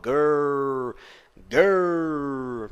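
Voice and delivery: put-on "Girl, girl" slowly overdone, with a heavily curled R and a final L; deliberately exaggerated "girl" for teaching; "girl" stretched out, with the R overdone. Shown as a demonstration. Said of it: "pode parecer um pouco ridículo".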